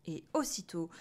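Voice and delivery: ordinary delivery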